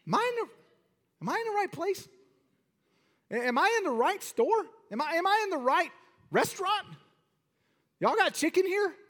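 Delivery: high, bewildered mock customer; comic high-pitched confused voice. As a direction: squeak out a baffled customer at the counter. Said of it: "you're like".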